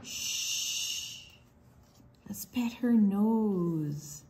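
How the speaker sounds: ordinary talk, nothing marked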